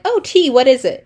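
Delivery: put-on chirpy teen daughter asking; mock girly daughter. Daughter asking her dad what the sacrifice is, high and eager. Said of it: "Iphigenia is like".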